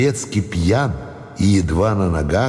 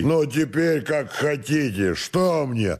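higher, slow tipsy defiance; resolute tone; 8 semitones higher, 4 dB quieter, slower than usual. Acted. Servant retreats behind the partition, resolute tipsy defiance. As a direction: dig in stubbornly without raising the volume. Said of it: "сказал решительным тоном Петрушка".

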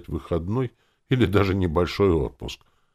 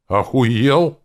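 exasperated, loud high rhetorical question; heated outburst; 9 semitones higher, 10 dB louder than usual. A narrator's reading. Narrator as the hero, exasperated by captain's message, heated. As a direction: snap it out in exasperation. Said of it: "в сердцах задал риторический вопрос".

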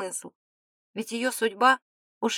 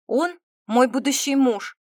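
slow, louder, higher stern declaration; 4 semitones higher, 6 dB louder than usual. A narrator's reading. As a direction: state it firmly, weighing each word. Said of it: "строго выдала девушка". (строго, с нажимом)

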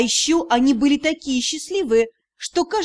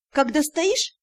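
plain reading voice

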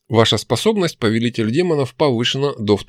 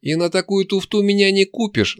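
yelling; 11 semitones higher than usual. A narrator's reading. Demon refusing to be fooled, mildly yelling. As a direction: refuse hotly with voice pitched up. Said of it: "заорала она".

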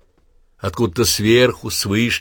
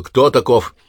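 raised, louder hoarse bark; hoarse sharp question; 5 semitones higher, 5 dB louder than usual. Acted. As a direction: snap it out hoarsely. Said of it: "сипловатый голос Черепа резко спросил".

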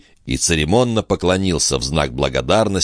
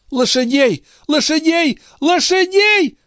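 shrieked command climbing higher each time; yelling; 4 dB louder than usual. A narrator's reading. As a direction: call for servants at a rising, piercing pitch. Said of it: "завопила госпожа Дюбари".

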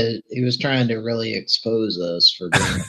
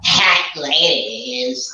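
very drawn-out, hammed-up leer; mock ogling; 3 semitones higher, much slower than usual. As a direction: stretch it out like a joke catcall. Mock ogling of game's ladies.

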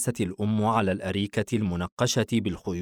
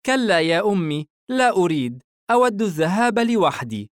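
put-on high, louder boy refusing; protesting son; 10 semitones higher, 5 dB louder than usual. Son turning to his mother, insistent high protest. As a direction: refuse as the son, pitched well up. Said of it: "التفت بورسن نحوها".

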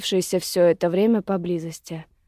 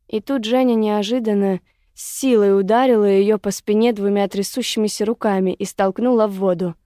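ordinary delivery, nothing marked

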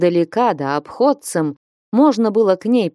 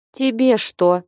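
ordinary delivery, nothing marked

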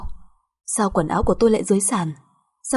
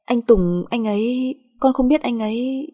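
higher, hesitant excuse; flustered; 4 semitones higher, slower than usual. Acted. Daughter-in-law answering mother-in-law about her husband, flustered. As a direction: stall nervously, pitch climbing.

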